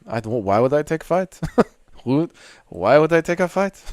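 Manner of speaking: lightly hammed-up French accent; mock French accent. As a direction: play the French fighter dodging a bout. French fighter impression resumes.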